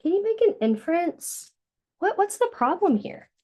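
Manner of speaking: ordinary delivery, nothing marked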